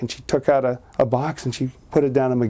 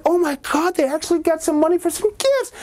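put-on high delighted child; high thrilled child; excited kid. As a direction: gasp with joy at the presents. Boy sees the gifts.

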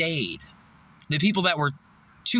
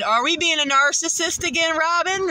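high, sing-song mock question; mocking taunt; 11 semitones higher than usual. Teasing the narcissist boss Robin, high and mocking. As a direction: talk down to her like a naughty child. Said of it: "and I said".

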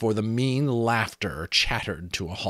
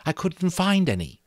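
nervous; 10 semitones higher than usual. A narrator's reading. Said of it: "she said nervously".

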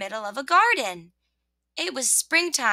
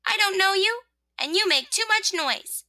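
high-pitched little scolding; scolding rosebud; 9 semitones higher than usual. Acted. Rosebud to the noisy rain, scolding.

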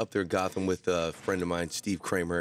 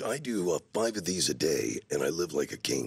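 trailer guy voice; 5 semitones lower than usual. Retold or imitated. Voicing LaFontaine bragging.

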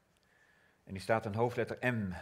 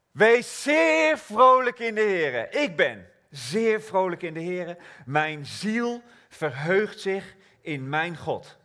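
higher and louder, slow; joyful exclamation; 7 semitones higher, 8 dB louder, slower than usual. Acted. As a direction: sing it out with gladness. Joyful declaration of verse.

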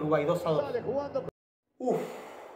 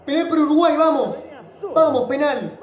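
excited shouting; 8 semitones higher, 6 dB louder, slower than usual. Real, not acted. Fan shouting for a penalty.

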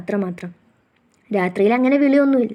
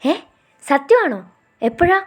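quick, higher, amazed questions; breathless surprise; 6 semitones higher, slower than usual. A narrator's reading. Girl hears her boyfriend's sister gave birth, breathlessly surprised. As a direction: gasp it out in wonder. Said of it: "അത്ഭുതത്തോടെ ഞാൻ ശ്വാസം വിടാതെ ചോദിച്ചു".

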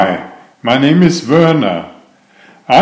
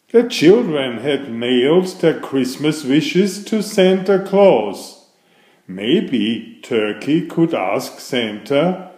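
lively read-aloud story voice; storytelling; 6 dB quieter than usual. A narrator's reading. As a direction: read it like a picture book to kids. Reads a picture book aloud.

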